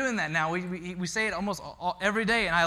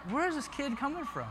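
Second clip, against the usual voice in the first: high-pitched, softer disbelief; amazed; 9 semitones higher, 5 dB quieter than usual. Retold or imitated. Dad marvelling at his preschooler's words, amazed. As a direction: wonder aloud in a high, hushed voice.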